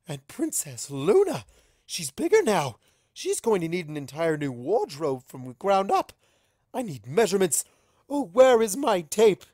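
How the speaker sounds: loud, high, frantic planning; manic excitement. Acted. Rarity frets over Luna's new wardrobe, frantic. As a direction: spiral into fashion panic.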